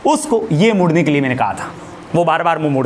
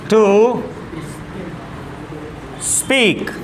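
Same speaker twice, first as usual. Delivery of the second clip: each word dictated alone with long pauses; slow dictation; much slower than usual. Shown as a demonstration. Teacher dictating an English sentence to students, word by word. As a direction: spell the sentence out slowly for writing down.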